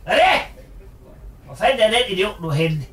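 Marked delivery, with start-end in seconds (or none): 0.0-0.4 calling out. Acted. Mother calling for her missing son, rising calls. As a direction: call out into the house, building each time.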